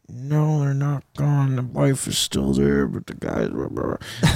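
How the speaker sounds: low, soft, near-whispered answer, fading to breath; hushed reply. Retold or imitated. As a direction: keep it down so the couple can't hear. Kelly answering discreetly near the couple.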